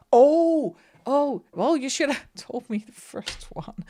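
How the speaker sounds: high loud oh, well, mock exasperated. Travel companion scolding the shop girl, mock exasperated. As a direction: play the exasperated friend. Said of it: "she asks if you want a bag".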